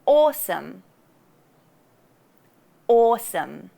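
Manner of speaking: "Awesome" with a round, open aw; rounded vowel. Shown as a demonstration. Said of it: "couple of words".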